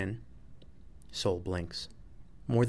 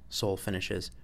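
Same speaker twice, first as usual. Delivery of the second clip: ordinary delivery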